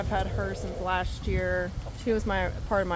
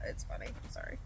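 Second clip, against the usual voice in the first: usual speaking voice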